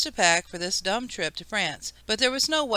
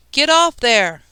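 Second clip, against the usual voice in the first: loud, raised-pitch scolding; snapped order; 6 semitones higher, 7 dB louder, slower than usual. A narrator's reading. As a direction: snap a command at a misbehaving child. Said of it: "Kate snapped".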